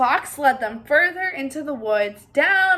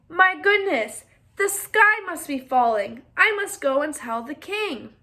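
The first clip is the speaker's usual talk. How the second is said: alarmed hen; 3 semitones higher, 4 dB louder than usual. Acted. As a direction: sound the alarm, pitched up and loud.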